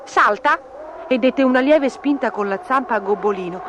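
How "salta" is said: high, short cry to jump; lightly shouted order; shouted command. A narrator's reading. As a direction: call it out suddenly, pitched up. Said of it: "Un momento dopo gridò".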